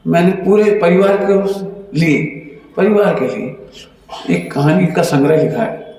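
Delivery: ordinary talk, nothing marked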